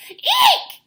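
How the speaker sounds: very high, loud shriek; shrieking eek. A narrator's reading. Mama bird seeing babies hanging upside down, shrieking. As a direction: shriek in shock. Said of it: "she cried".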